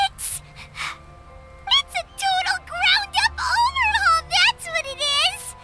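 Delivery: high, gushing amazement; thrilled, high-pitched exclamation; amazed excitement. Acted. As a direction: burst out after a stunned stammer. Amazed by the amended painting.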